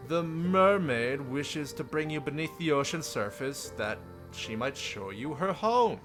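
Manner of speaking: ordinary talk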